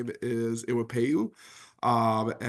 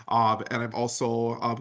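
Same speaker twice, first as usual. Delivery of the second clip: normal talking voice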